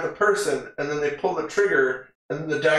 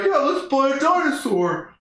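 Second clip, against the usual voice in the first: a higher mock-eager rich person; ricchi entusiasti; 12 semitones higher than usual. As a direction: sell the silly idea like a bored rich kid. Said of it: "they're just like".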